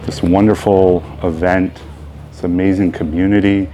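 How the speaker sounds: unhurried phrases with long, spacious pauses; guided meditation. Real, not acted. Teacher leading the opening meditation of a beginners' yoga class, unhurried. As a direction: guide them into ease, leaving room to breathe.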